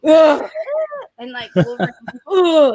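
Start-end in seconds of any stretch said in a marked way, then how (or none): 0.1-0.5 loud grunting groan; effort grunt. Retold or imitated. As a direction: grunt like throwing a punch. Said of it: "he was like".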